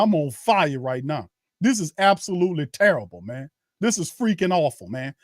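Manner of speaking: usual speaking voice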